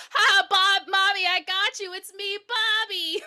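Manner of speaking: high, loud singsong reveal; taunting little-boy voice; gleeful evil child. Acted. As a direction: crow triumphantly like a naughty kid.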